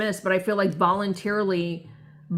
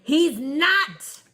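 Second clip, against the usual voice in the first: mock snapped denial; 7 semitones higher than usual. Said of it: "jumps in and says".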